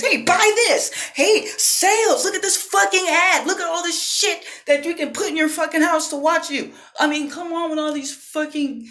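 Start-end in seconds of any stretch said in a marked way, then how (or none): 0.0-6.7 mock pushy salesperson. Pushy advertiser shoving sales in his face, mock hard sell. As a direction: shove the deal at them, mocking and insistent. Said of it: "stupid fucking sales are getting pushed in my face".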